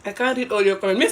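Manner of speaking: ordinary delivery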